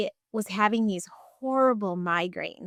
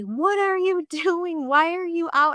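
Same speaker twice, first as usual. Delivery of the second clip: imitating a scream; 8 semitones higher, slower than usual. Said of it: "starts screaming at me".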